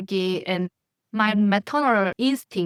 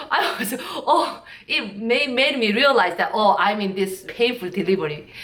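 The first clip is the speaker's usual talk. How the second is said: ordinary talk, nothing marked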